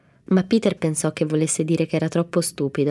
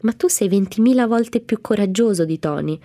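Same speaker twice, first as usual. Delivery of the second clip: higher and louder, worked-up protest; heated insistence; 4 semitones higher, 4 dB louder than usual. A narrator's reading. Mamie flustered, insisting to Peter, higher and louder. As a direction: insist hotly he's braver. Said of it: "lei si innervosì".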